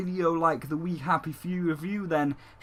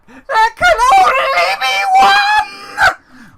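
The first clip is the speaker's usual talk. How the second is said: a huge, drawn-out, higher shout, slow; epic proclamation; 13 dB louder, much slower than usual. Acted. Proclaiming to Moon Knight there is only one waifu, in a huge slow shout. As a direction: bellow it like a movie battle cry. Dramatic Highlander-style proclamation.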